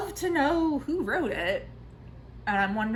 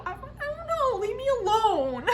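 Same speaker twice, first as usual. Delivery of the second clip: much higher, squeaky mock whine; mock whiny protest; 13 semitones higher than usual. Brushing off her horrified friend Michaela, squeaky mock whine. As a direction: squeal it like a cornered kid begging to be let off.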